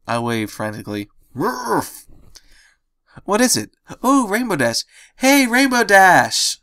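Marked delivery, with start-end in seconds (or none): 1.4-2.1 frantic woof. Acted. Andrew barks for help. 3.3-6.6 higher and louder, stretched slow; excited scream. Acted. Pinkie screaming a greeting to Rainbow Dash outside, loud. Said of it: "Pinkie screamed".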